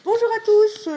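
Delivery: loud, high announcer greeting; bright presenter hello. Acted. Presenter greeting the audience, loud and high. Presenter opens her comic report.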